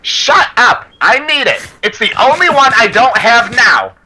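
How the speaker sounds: high-pitched mock tantrum of a kid; bratty shouting kid. Kid talking back to his mom, high mock shout. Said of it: "the kid just shouts back".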